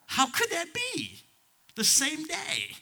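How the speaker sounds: very high, slow, strained; high pained wonder. Real, not acted. Pastor recalling his son's accident, high and strained. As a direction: let the voice crack upward.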